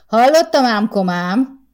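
loud, high taunting call; calling back. A narrator's reading. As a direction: throw it back across the fence. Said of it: "szólt vissza Márton".

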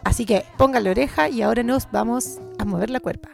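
ordinary talk, nothing marked

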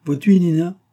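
touched mother, high and loud; moved. A narrator's reading. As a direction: appeal to the daughter with feeling. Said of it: "dit enfin madame Karsdorff émue".

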